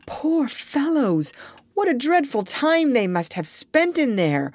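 tender, high exclamation of pity. A narrator's reading. Doctor pities the trapped men.

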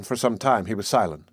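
ordinary delivery, nothing marked

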